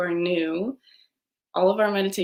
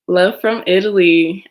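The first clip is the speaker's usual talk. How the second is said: loud, slow read-out of the comment; excited reading; 11 dB louder, slower than usual. Real, not acted. Host reads the Italian viewer's love aloud, loudly. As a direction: call the comment out with joy.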